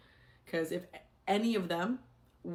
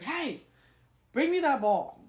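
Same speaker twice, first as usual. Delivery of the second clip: high, loud call across the gym; 8 semitones higher, 7 dB louder, slower than usual. Retold or imitated. Teacher to a student with the ball, calling out. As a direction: holler for someone's attention, voice pitched up.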